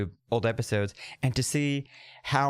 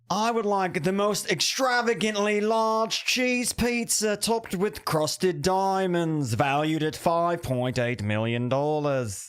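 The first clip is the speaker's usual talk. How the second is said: slow, grand, higher showbiz announcer voice; Robin Leach impression; 5 semitones higher than usual. Robin Leach ordering a diamond pizza from Domino's, grand announcer. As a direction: savour every extravagant word like a luxury host. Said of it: "that was good".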